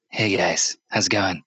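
lightly voiced salesman, pitched low. Store clerk greeting his own friend, salesman voice. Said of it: "he was using his salesman voice".

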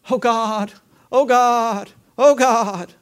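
mock worried moaning to God; mock fretful prayer. Preacher mocking a worrier's anxious prayer, lightly whining. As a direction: whine it like a fretting owl. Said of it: "you sound like an owl".

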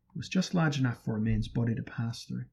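ordinary delivery

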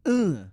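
short, punchy "uh" to show roughness; rough grunt. Shown as a demonstration. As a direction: demonstrate a rough accent with one grunt. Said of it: "speaks very, like, rough".

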